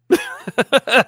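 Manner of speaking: high burst of laughter; laughing. Real, not acted. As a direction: laugh out loud at the joke. Laughs at own Darkhawk joke.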